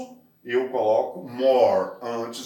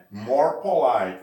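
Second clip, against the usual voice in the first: "More polite" said slowly, a bit louder; slow model phrase; 5 dB louder, slower than usual. Shown as a demonstration. Teacher modelling the phrase slowly and clearly. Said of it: "Ela tem três sílabas".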